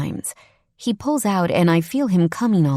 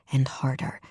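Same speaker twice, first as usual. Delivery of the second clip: whisper; 4 semitones lower, 7 dB quieter than usual. A narrator's reading. Whispered erotic narration.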